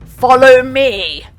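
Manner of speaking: slow booming battle call; rallying cry. A narrator's reading. Badger leading the charge, slow booming cry. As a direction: thunder it out to lead the charge.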